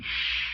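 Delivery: quick breathy shush; sharp hush. A narrator's reading. Thornier hushing Rick to listen for noises. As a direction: hush him hard, listen for the stairs. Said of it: "lifted a finger to his mouth and went".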